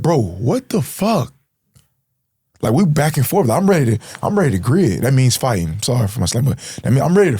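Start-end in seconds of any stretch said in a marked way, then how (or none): 0.0-1.3 higher, slow, indignant demand; confronting friend. Retold or imitated. Confronting the shooter in the car, slow indignant demand. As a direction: call him out in disbelief. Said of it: "I'm like".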